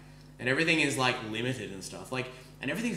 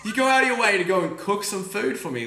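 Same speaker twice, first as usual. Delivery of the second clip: marveling; 7 semitones higher, 7 dB louder than usual. Real, not acted. Marveling at bartender's generosity.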